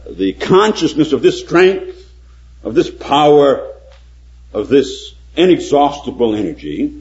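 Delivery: ordinary delivery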